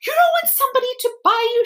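very high, fast gasping disbelief; be scandalised like a vain doll; shocked doll. Acted. The china doll shocked that Edward doesn't want buying, scandalised. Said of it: "The doll gasped".

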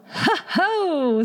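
high, hooting skull laugh; spoken ho-ho in a high creature voice; gleeful talking skull. A narrator's reading. Head bone mocks the fiddler and widow, gleeful. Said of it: "said the head bone".

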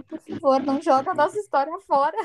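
self-mocking, soap-opera imploring; mock desperate plea. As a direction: plead like a melodramatic, rejected ex. Acted humiliated plea to a partner.

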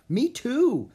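high, loud, eager agreement; thrilled kid joining in; excited agreement. A narrator's reading. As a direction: chime in eagerly, pitch leaping up. Annie shares Jack's excitement.

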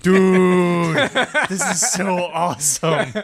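laughing, loud and delighted. Real, not acted. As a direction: burst out laughing with excitement. Delighted by the troll horn.

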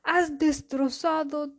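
angry king voice. Acted. King furious over his armour.

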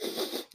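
one quick imitated sniffle through the nose; short retold sniffle after tears. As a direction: sniff once, like you've just been crying.

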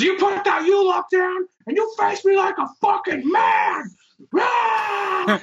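high belligerent challenge ending in a roar; imitated screaming. Imitating a drunk challenging the worker holding a U-lock, screaming. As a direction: play the drunk screaming for a fight. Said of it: "just screaming".

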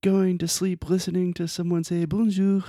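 oversell it like an ad guy; hyped marketer voice. Retold or imitated. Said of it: "internet marketers who are like".

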